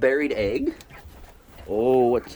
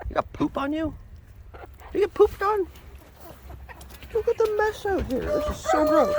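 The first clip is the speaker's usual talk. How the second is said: high squeaky disgust at the birds; high pet-talk disgust; pet baby talk. Acted. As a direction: coo mock-grossed-out at the chickens. Talking to dirty chickens.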